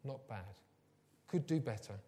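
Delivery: understated dad. Retold or imitated. Dad answering his children about school reports, understated. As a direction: lightly imitate an unimpressed dad. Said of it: "he always say".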